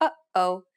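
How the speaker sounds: uh-oh with an abrupt stop between syllables; glottal stop. Shown as a demonstration. Teacher reminds learners of the stopping sound, uh-oh with glottal stop. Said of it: "that stopping sound, or the hyphen in".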